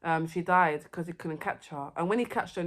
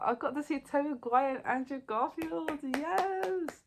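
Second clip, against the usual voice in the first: higher, imitated fangirl squeal; mock excited scream; 7 semitones higher, slower than usual. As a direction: relive the thrill of seeing them. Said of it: "I was, like, screaming".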